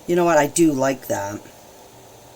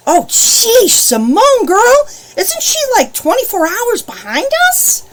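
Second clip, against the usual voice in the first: high, loud amazed exclamation; pitched-up disbelief; surprised; 13 semitones higher, 5 dB louder than usual. Real, not acted. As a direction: marvel out loud at the distance. Surprised at a faraway viewer.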